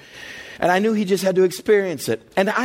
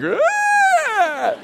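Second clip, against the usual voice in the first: high, sustained mock shriek; imitated child's scream. Kid refusing to jump in the pool, mock terrified scream.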